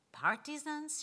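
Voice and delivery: taunting question; needling. Acted. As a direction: prod her with one pointed word. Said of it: "she needled".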